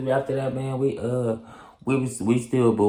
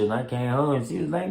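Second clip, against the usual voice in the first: ordinary talk, nothing marked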